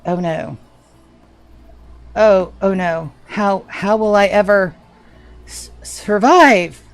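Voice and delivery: mock dismay, drawing out "survive"; sharp sarcasm. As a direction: play helpless victim with heavy sarcasm. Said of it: "The sarcasm is sharp today".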